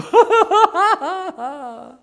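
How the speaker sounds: loud high mocking laugh. Clerks shut the ticket window at three, loud mocking laugh.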